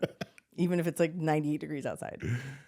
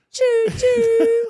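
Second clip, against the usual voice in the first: very high, loud choo choo; train whistle; 12 dB louder than usual. Retold or imitated. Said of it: "he started going".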